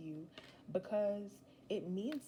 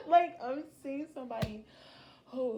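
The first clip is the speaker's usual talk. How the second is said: laughing; 7 semitones higher, 11 dB louder than usual. Real, not acted. Still laughing after the laugh.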